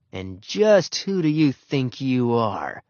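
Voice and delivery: slow growling challenge; dog's growl. A narrator's reading. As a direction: growl the words slowly, like a dog squaring up. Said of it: "Ribsy's growl seemed to say".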